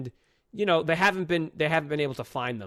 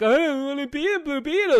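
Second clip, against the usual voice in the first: mock whine, pitched much higher and louder; whiny angsty teen; 11 semitones higher, 6 dB louder than usual. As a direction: mimic a sulky teenage hero. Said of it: "He's all angsty because he's like".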